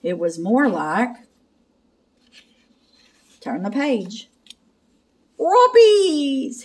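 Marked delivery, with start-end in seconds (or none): 5.4-6.6 very high, drawn-out doggy cry; high cartoon dog voice; Scooby-Doo. A narrator's reading. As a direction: announce it like an excited cartoon dog. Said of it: "he said".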